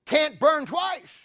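slow, high, shouted punchline; preaching shout. Acted. Preacher crying out the key line to the church, slow shout. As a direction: cry it out slowly to the church.

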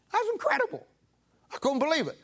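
put-on high childlike awe; amazed. Preacher recalling watching as a boy, high amazed voice. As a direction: squeak it out like a stunned little boy.

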